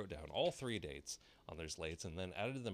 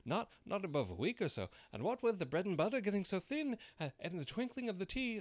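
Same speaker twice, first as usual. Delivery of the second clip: trembling voice; 13 semitones higher, 6 dB louder than usual. A narrator's reading. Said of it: "in a trembling voice".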